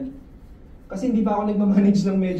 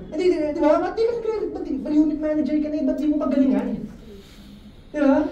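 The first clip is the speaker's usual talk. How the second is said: mock exasperated scolding; 7 semitones higher, faster than usual. Scolding a listener who won't recruit.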